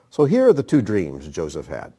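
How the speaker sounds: usual speaking voice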